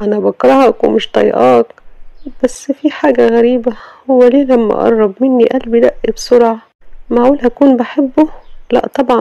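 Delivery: Sama talking to herself. Acted. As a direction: vent alone, then wonder about her heart.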